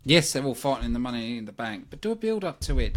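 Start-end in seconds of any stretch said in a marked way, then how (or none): none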